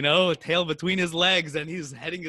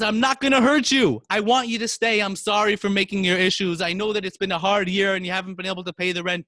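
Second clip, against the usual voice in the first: high, raised voice; insistent, high-pitched reassurance; pleading through door; 6 semitones higher, 6 dB louder than usual. Acted. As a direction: plead loudly to be let in.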